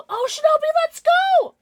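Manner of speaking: high squealing cheer; excited cheer. Real, not acted. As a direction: cheer loudly for a friend. Cheering a viewer's news.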